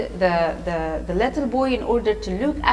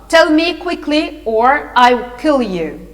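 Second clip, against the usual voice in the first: loud villainous threat; shouted threat; 4 semitones higher, 6 dB louder than usual. A narrator's reading. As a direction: shout the order, then draw out the threat. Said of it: "the old man shouted".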